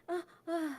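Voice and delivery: ordinary talk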